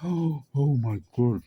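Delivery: sharp gasp, then high Oh my God. Retold or imitated. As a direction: relive the shock with a gasp. Reliving waking up screaming.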